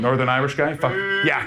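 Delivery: ordinary delivery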